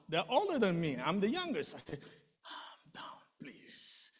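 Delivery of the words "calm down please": very soft whispered plea; whispering to calm panicked brothers; soothing whisper. Retold or imitated. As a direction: hush them gently. Calming older brothers at bedside.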